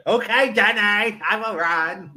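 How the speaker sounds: slow, high-pitched simpleton impression; Forrest. Answering Jenny's call to run, slow earnest movie impression. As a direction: play the earnest film hero, stretching the words. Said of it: "I'd be like Forrest".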